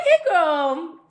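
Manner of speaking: put-on high, bright "Hey, girl" with a lifted rise; sing-song high hey girl; squealed greeting. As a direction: greet her like an excited old friend. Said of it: "I didn't say, "Hey, girl," like that".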